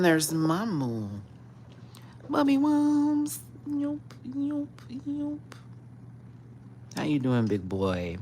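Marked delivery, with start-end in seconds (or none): 2.3-5.4 very high, slow cooing. Real, not acted. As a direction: coo up high at the cat. Baby talk to the cat.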